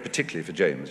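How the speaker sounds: normal talking voice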